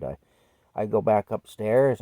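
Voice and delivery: ordinary talk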